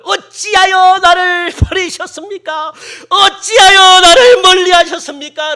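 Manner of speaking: loud, high, drawn-out wail; acted heart-rending lament to God; tearful crying out. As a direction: shout it through weeping, stretching the words. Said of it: "가슴을 찢으면서 막 울면서".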